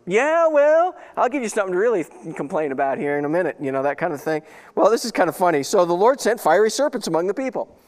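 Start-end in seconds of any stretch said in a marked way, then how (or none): 0.1-3.6 mock-scold whining kids, starting high and settling; parent's mock threat. Father warns his whining younger boys, exasperated mock threat. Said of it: "you say that kind of stuff to 'em".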